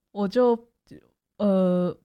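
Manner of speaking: normal talking voice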